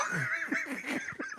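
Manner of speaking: a sudden squeal of laughter; high laugh. Real, not acted. Laughing at her candy surprise, high squealing laugh. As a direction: crack up high after the punchline.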